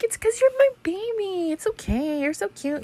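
high, soft cooing mom voice; baby talk. Retold or imitated. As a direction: coo it as if to a baby. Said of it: "I talk like that to my ten-year-old".

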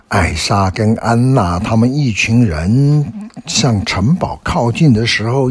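ordinary delivery

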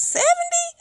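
very high, squeaked question; incredulous. Real, not acted. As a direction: pitch leaps up in disbelief. Disbelief at used tire price.

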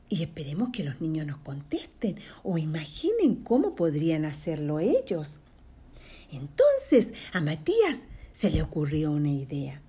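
plain reading voice

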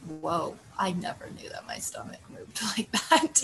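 whispered, laughing mock amazement; 웃음 섞어 속삭이듯 놀라며; amused whisper. Retold surprise at own stomach.

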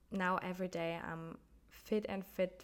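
ordinary delivery

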